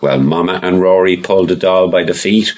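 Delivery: plain reading voice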